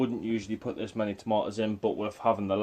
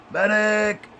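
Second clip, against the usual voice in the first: much higher, louder mock battlefield yell; man down shout; 12 semitones higher, 6 dB louder than usual. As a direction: bellow for the medic, hammed-up. Snapped lily, calls for medic.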